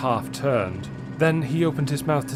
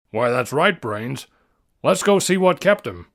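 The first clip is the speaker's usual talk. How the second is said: louder, decisive father; brisk and concerned; Jeff, firm; 4 dB louder than usual. Acted. Jeff to Brains, deciding to check on John.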